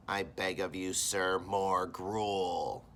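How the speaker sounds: very slow, drawn-out pleading orphan; begging orphan. Acted. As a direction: stretch it out, playing the beggar boy. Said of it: "And he always goes".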